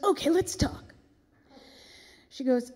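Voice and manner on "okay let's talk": higher-pitched star impression. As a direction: announce it as the diva would. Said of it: "And she goes".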